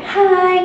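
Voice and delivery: "Hai" held high and level; first tone. Shown as a demonstration. Teacher showing the first tone, "Hai" high and level. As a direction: stretch "Hai" on one high, unwavering note. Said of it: "datar dan tinggi".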